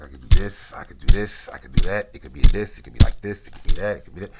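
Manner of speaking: loud, punchy bursts, each line ending up; showing off his moves to the guy, loud and pumped; hyped self-talk. Retold or imitated. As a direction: psych yourself up, chopping the air. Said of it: "I, I was like".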